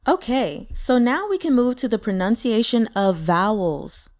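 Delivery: ordinary talk, nothing marked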